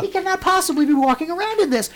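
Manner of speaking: high, incredulous comic protest; retold disbelief. Retold outrage at the shirt.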